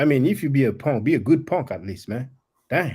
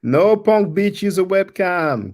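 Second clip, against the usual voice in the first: taunting shout; 6 semitones higher, 5 dB louder, slower than usual. Acted. Repeats the chat insult, shouting.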